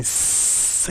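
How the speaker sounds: long cat-like hiss; drawn-out "sss"; imitated hiss. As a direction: hiss like a stage villain with cat eyes. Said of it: "and he's going".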